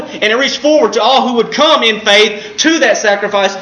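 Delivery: ordinary talk, nothing marked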